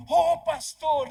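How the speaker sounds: loud, drawn-out high call across the yard; joyful call. Retold or imitated. Fernando calling out to the pastor, loud and slow. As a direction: stretch it out high and loud, greeting.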